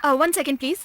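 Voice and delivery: ordinary delivery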